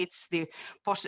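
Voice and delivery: ordinary talk, nothing marked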